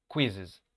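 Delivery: "Quizzes" said KWIZ-iz, first syllable stressed. Shown as a demonstration. Teacher marking the accent for students. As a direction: lean on the "Quiz" of "Quizzes". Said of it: "El acento va en la primera sílaba".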